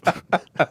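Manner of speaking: breathless high-pitched giggle. Real, not acted. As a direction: giggle helplessly at the joke. Laughing at the letter.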